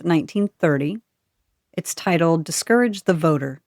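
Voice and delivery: ordinary delivery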